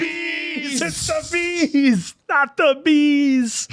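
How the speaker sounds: movie quote panic. Retold or imitated. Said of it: "I don't even know what movie that's from".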